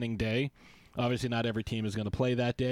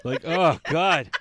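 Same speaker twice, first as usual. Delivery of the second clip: laughing; 8 semitones higher, 7 dB louder than usual. Real, not acted. Laughing at his own ham.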